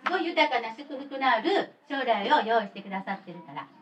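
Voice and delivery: usual speaking voice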